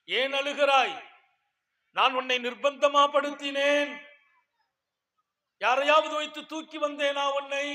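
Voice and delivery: high, loud, pleading questions from the pulpit; loud sermon voice voicing the man; preaching at full force. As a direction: shout the man's challenge to the hall. Man questions the weeping woman.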